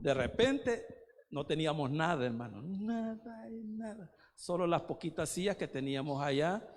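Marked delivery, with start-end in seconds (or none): 2.6-4.1 soft, high, drawn-out mock wail; imitated tearful lament. Preacher recalling his prayer when the church had nothing, mock-tearful lament. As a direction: lament it slowly, as if weeping. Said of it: "yo estaba llorando".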